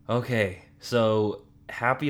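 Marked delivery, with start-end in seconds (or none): none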